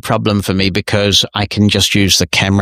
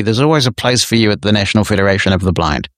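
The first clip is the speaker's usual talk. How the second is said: ordinary talk, nothing marked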